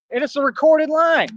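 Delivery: ordinary delivery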